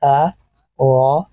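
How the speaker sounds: sounds stretched very slowly and joined; slowly sounded out. Shown as a demonstration. Teacher blending sounds for learners, very slowly stretched. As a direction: drag each sound out, linking them. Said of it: "تو دو آوازوں کو جوڑیں گے اسی طرح سے".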